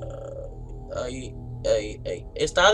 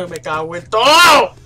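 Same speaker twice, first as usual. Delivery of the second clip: loud drawn-out swearing; loud slow curse; 2 semitones higher, 12 dB louder, slower than usual. Real, not acted. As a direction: spit the curse out slowly. Maldice con el susto. (maldición fuerte y lenta)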